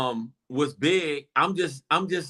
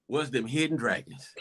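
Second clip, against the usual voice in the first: laughing; 4 semitones lower, 7 dB quieter than usual. Real, not acted. Bursting into laughter at own joke.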